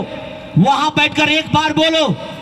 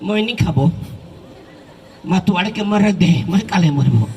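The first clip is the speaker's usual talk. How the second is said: wife refusing; 6 semitones lower than usual. Retold or imitated. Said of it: "पहला पत्नी बोला".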